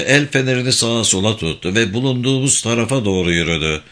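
usual speaking voice

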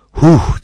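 loud, high puff of breath; letting out a pent-up breath; heavy exhale. A narrator's reading. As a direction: blow the breath out hard in a "huh". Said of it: "diye nefesini boşalttı".

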